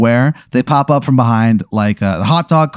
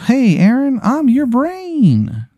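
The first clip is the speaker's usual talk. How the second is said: much higher, very slow odd voice; brain-double greeting; 14 semitones higher, much slower than usual. Acted. His mustached brain double greeting Aaron in a high slow voice. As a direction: stretch it out eerily high.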